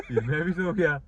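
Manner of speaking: giggling through the words; can't stop laughing; laughing while talking. Real, not acted. Laughs through his own story.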